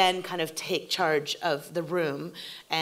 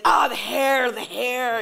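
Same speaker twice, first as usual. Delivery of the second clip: higher, louder "Oh, the hair, the hair"; excited gush; 8 semitones higher, 8 dB louder, slower than usual. Retold or imitated. Showrunner distracted by dailies on the TV, excited gush. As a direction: gush over the dailies on the monitor. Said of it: "I'll look up and be like".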